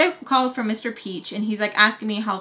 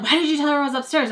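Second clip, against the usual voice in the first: mock sharp question; 6 semitones higher, 4 dB louder, faster than usual. Friend snapping at her.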